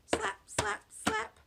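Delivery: high, loud punchy slaps; slapping sound. A narrator's reading. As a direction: smack out each slap. Story sound effect of slapping.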